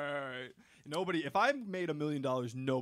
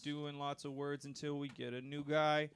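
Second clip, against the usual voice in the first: usual speaking voice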